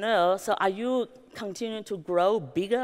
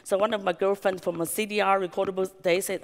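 usual speaking voice

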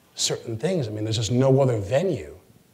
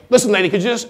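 mock snap; 10 semitones higher, 6 dB louder, faster than usual. Candidate snapping at woman.

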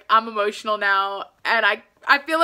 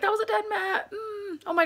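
tearful; 9 semitones higher, 5 dB quieter than usual. Real, not acted. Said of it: "now I'm over here just crying".

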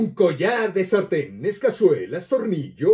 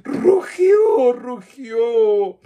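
much higher, louder roaring cry; monster roar; 4 semitones higher, 6 dB louder, much slower than usual. Acted. Fire-breathing monster roaring at the children, loud high cry.

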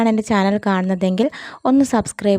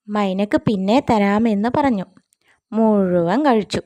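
ordinary delivery, nothing marked

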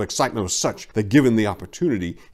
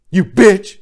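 much higher, loud furious snap; threatening snap; 10 semitones higher, 9 dB louder than usual. A narrator's reading. Prancer snapping at the doe he just attacked, loud furious. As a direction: spit the insult at her. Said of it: "he snapped threateningly".

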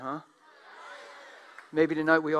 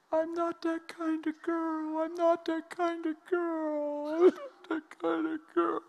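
much higher, slow, tearful woman's plea; crying woman; 13 semitones higher, slower than usual. Retold or imitated. Ashamed woman crying to the preacher, high and slow. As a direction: play the weeping woman, begging slowly. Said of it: "and she says / cries".

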